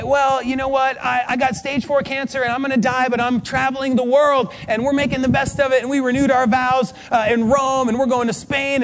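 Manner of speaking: high, cheerful rush of news; happy, upbeat. Retold or imitated. Friend gaily sharing cancer news and travels, happy and upbeat. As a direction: breezily imitate someone bubbling over. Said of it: "she's just so happy".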